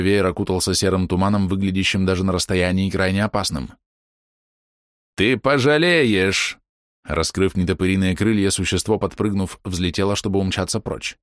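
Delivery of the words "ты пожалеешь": monster threat. Acted. Insect monster threatens, flees.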